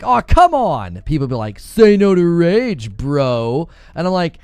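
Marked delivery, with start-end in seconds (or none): 0.0-1.0 drawn-out, loud, higher exasperation; mildly frustrated. Retold or imitated. Streamer recalls reacting to a game, mildly frustrated. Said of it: "a little frustrated, I'd be like". 1.6-3.7 slow, loud, drawn-out taunt; mocking viewers. Streamer mimics viewers calling him out, mocking.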